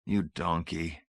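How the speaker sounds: soften, fondly chide her; husband tenderly teasing wife. A narrator's reading. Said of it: "Hamilton's heart softened".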